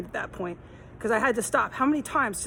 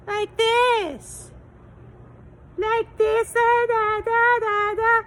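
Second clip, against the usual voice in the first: loud, much higher mocking baby voice; baby talk imitation; 13 semitones higher, 6 dB louder, much slower than usual. Mocking the neighbor woman on the sidewalk, loud high baby talk. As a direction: squeal it like a grown woman talking baby talk through a mask. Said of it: "Really loud through her face mouse, like a baby".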